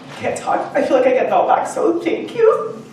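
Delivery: real tears, voice catching and breaking up high; fighting back tears while saying thanks; choked up. Real, not acted. Speaker thanking the audience, overcome and tearful. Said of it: "I'm gonna cry now".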